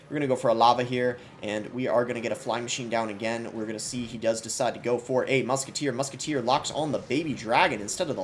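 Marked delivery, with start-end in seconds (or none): none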